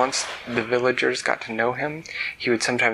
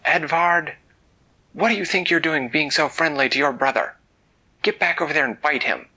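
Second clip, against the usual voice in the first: louder, higher mock scold; scolding dragon parents; 4 semitones higher, 5 dB louder than usual. Dragon parents scolding Edvard for being friendly, mock stern scold. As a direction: bark at your son like a stern dragon parent. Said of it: "They would say".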